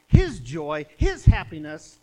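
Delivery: preacher booming scripture to the church; preaching burst. Acted. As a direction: belt out the joy of the verse.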